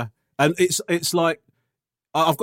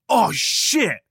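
mock-alarmed cry, raised in pitch; retold alarm; 5 semitones higher, 4 dB louder than usual. Dentist exclaiming midway through a root canal, mock alarm. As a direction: imitate a startled exclamation.

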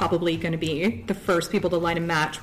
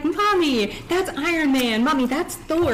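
put-on little kid voice; 8 semitones higher than usual. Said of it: "he's like".

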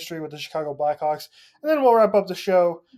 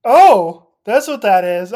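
surprised realization; 9 dB louder, slower than usual. Real, not acted. Learns source of mystery noise.

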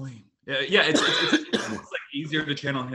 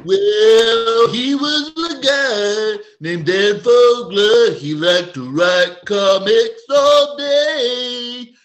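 high, loud, very slow storyteller; 8 semitones higher, 11 dB louder, much slower than usual. Pretend. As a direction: croon it slowly like a folksy narrator. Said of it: "have you ever done [name]?".

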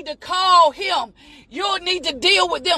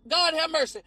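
muttered aside; 7 dB quieter than usual. Real, not acted. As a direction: drop it under your breath.

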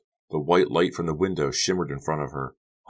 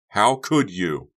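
slow, loud, raised accusation; angry accusation; 5 semitones higher, 5 dB louder, much slower than usual. A narrator's reading. Becca losing control at Honey, angry accusation. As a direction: drag it out in outrage.

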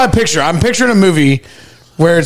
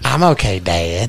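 lower, softer mock kid voice; Bobby Hill; 12 semitones lower, 4 dB quieter, slower than usual. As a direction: squeak it out like a cartoon boy. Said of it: "Bobby Hill impression".

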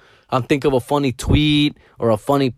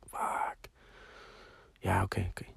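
sleepy whisper; 6 semitones lower, 18 dB quieter than usual. Retold or imitated. Just woken up, groggy reply.

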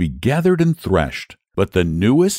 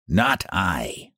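low, much softer ratty refusal; fat rat; 3 semitones lower, 6 dB quieter than usual. Acted. Rat refusing the hen, low soft voice. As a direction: mumble it like a sleepy fat rat. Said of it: "And the rat said".

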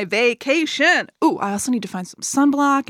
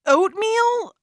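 surprised question; 12 semitones higher than usual. Acted. Announcing the week's topic.

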